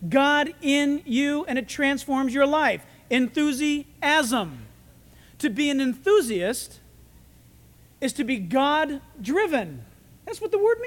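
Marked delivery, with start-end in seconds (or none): 3.1-4.7 enthusiasm drawn out very slowly in separate parts; syllable by syllable. Shown as a demonstration. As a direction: break enthusiasm apart, stretching each part. Word repeated broken into parts.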